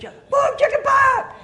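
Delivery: very high, squealed shout; kids echoing. Acted. Kids repeating after the pastor, very high shout. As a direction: shout it back like excited kids. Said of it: "You know, the kids".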